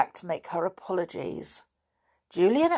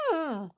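soft, high whimper; dog's whine; 8 semitones higher, 5 dB quieter than usual. A narrator's reading. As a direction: imitate a dog whining softly.

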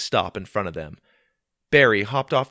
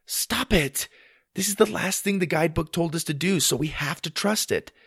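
high-pitched, insistent sister; arguing; 8 semitones higher than usual. Acted. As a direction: cut off the panic and insist. Twins arguing over waiting.

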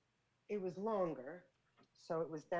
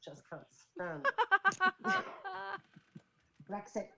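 put-on English accent; 3 dB louder than usual. Woman performing for friends on request, English accent. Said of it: "Do that English accent again".

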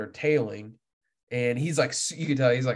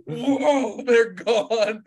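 excited disbelief; 9 semitones higher, 5 dB louder, much slower than usual. Real, not acted. Fish scatter after a bad cast.

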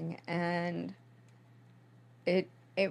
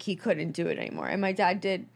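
ordinary talk, nothing marked

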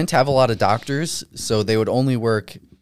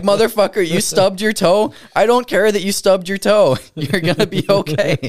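exasperated retort; 7 semitones higher, 3 dB louder than usual. Retold or imitated. Mocking annoyed patient, retold.

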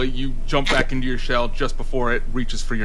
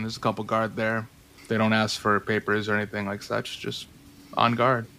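scene setting; 6 dB quieter than usual. Acted. Game master describes arrival.

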